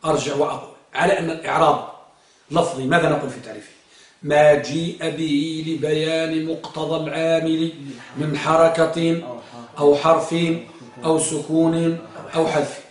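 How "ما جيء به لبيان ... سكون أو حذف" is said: measured, word-by-word recitation; deliberate dictation of a memorised rule; slow definition recital. Shown as a demonstration. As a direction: recite slowly, pausing before each listed item. Teacher recites the definition slowly.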